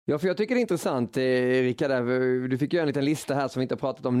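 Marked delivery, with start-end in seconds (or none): none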